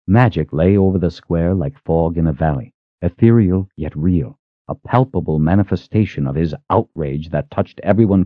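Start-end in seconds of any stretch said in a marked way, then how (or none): none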